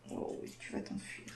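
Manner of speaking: whispering over a crib. Real, not acted. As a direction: hush it to the little one. Whispering to escaping baby.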